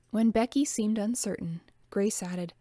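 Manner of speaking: ordinary delivery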